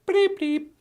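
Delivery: very high beeping noises; computer beeps. Acted. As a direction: beep like an old machine.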